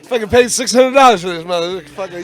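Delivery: loud, high indignant rant; retold anger. Show runner ranting at a fan, loud and indignant. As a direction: vent loudly at being called DJ.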